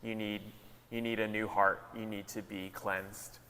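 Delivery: acted sober counsel, softening toward the end. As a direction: tell him gravely and quietly, letting it sink. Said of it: "soberly telling him".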